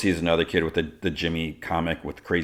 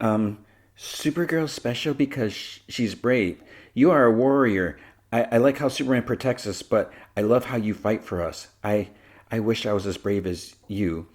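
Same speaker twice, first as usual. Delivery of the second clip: put-on higher, hesitant child's voice; shy little girl; 5 semitones higher than usual. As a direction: play an admiring little girl, faltering at first.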